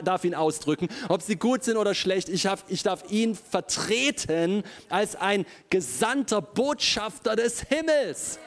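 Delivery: usual speaking voice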